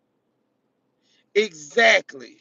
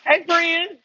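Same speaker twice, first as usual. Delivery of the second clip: high, loud hello to a friend; loud greeting; 8 semitones higher, 6 dB louder than usual. Real, not acted. Shopper greeting a friend in the store, loud and cheerful. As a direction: call out cheerfully.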